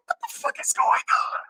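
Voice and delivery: high, hushed squeak of disbelief; high-pitched, airy reaction under the breath; mock bewilderment. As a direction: act out a stunned whisper-squeal. Acted reaction to her outburst.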